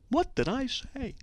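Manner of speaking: soft, high, slow question; mock innocence. Host asks what provoked the hate mail, mock innocent.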